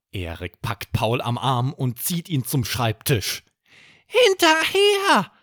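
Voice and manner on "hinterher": sehr hohe, laute, gedehnte Tierstimme; Barney ruft. Acted. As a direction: ruf es hoch und kräftig wie ein kleines Zauberwesen. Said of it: "ruft eine Stimme, die wie die von Barney klingt". (Barney ruft laut mit hoher Fabelstimme)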